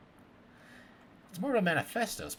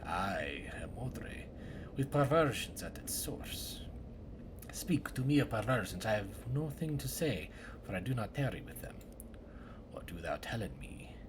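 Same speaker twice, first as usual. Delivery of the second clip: archaic woodcutter drama; 2 semitones lower than usual. Acted. Woodcutter interrogating suspects about the murder, hushed and theatrical. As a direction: play a solemn woodcutter grilling suspects in a murder.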